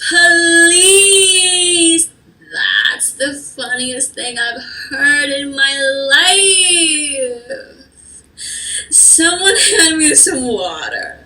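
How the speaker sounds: high, loud scornful sneer; high mean-girl mockery; mocking laugh-talk. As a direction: laugh in her face.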